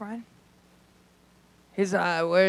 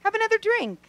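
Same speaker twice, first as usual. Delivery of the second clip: mock soothing; 10 semitones higher, 5 dB louder than usual. Wife coaxes drugged boyfriend back to sleep, high and sugary. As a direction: lull him back with fake sweetness. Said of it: "I'd go".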